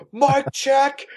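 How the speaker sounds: high, nasal-free mock call of a scrawny activist; mocking protester. Mocking the leftist who starts the mic check, high mock call. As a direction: lampoon the leader calling out. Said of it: "the leftist with the ... hat and the red shirt goes".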